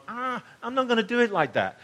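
high, brisk dismissive aside; John's inner voice. Retold or imitated. Pastor voicing John rejecting the other Gospels, high and brisk. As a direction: toss it off high, like deciding on your own way. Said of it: "and gone".

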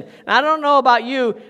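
ordinary delivery

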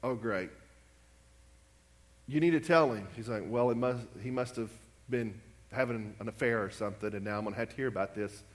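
low, weary aside to himself; a lightly voiced, dreading pastor; inner groan. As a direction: dread hearing a confession. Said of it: "Pastor Wilkerson's sitting there going".

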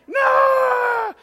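high, loud tearful refusal; a terrified kid refusing to go; crying no. Retold or imitated. Crying child refuses slide.

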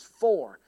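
"for" hit loud and alone; stress on FOR. Shown as a demonstration. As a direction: punch the single word "for". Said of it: "I would put emphasis on each word".

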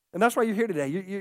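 ordinary talk, nothing marked

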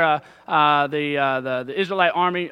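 usual speaking voice

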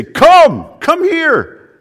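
high, loud cry of warning; urgent parent's call. Acted. Parent seeing child about to get into danger, urgent shout. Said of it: "it's the idea of a parent".

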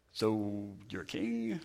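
a light sneer under the breath; mocking Pilate. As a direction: Pilate quietly mocking Jesus' kingship. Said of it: "Or if he was mocking him".

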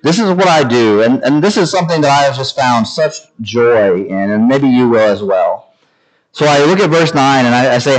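usual speaking voice

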